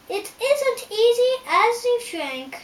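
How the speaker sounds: very high, loud taunt; harsh mockery. A narrator's reading. Armless man taunts the tumbled Scarecrow, harshly mocking. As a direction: crow it loudly over the fallen challenger. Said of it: "the man laughed harshly as he f".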